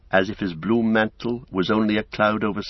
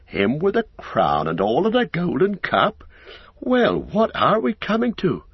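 high, swooping disbelief; astounded; 10 semitones higher than usual. A narrator's reading. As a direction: exclaim like a flabbergasted innkeeper. Innkeeper astounded by the news.

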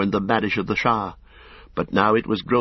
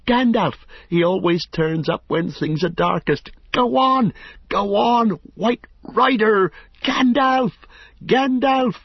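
much higher and louder cheering, stretched out; wild shouting; 13 semitones higher, 4 dB louder than usual. A narrator's reading. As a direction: urge on a runner far ahead. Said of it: "he shouted wildly".

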